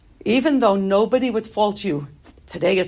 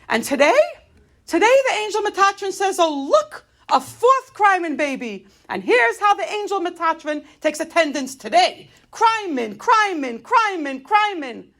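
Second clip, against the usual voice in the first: much higher, escalating mock roll call; aggravated rant; 10 semitones higher than usual. Bereaved mother mocking the angel's attendance call, aggravated. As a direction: rant in a high, exasperated voice, hammering the repeated name. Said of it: "going off on one of my things".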